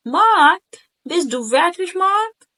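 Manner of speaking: high, loud surprised question on seeing him; really react, pitch lifting on مارك; genuine surprise. Shown as a demonstration. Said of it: "التون بتاعتك تحسسني إنه أنت فعلًا". (نبرة اندهاش حقيقي)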